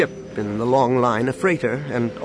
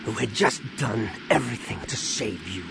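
growl; 6 dB quieter than usual. A narrator's reading. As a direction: growl it at your son, holding back. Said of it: "Han said with a growl".

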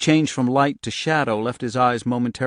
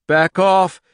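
shouting; 8 semitones higher, 9 dB louder than usual. Acted. Girl fights her fire power.

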